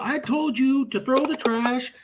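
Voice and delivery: high, slow mock scolding; scolding mom. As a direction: imitate an exasperated mother, drawn out and high. Retold scolding by mother.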